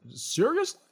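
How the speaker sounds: high, cracking question of disbelief. Real, not acted. Doctor reacting to perfect samples, voice cracking. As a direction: let the voice crack upward in surprise. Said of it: "why was my voice cracking?".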